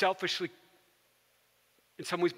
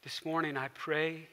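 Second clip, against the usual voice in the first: closing prayer; 3 semitones lower than usual. Real, not acted. Pastor leads closing prayer.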